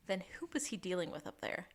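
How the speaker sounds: spooky whisper. Acted. Ominous mystery question.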